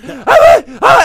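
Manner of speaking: high, loud gasps, imitating someone unable to talk; mock gasping for words through laughter; squeaky wordless attempts at speech, acted out; imitated helpless laughter. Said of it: "he's laughing so hard".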